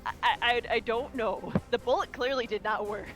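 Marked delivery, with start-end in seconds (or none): none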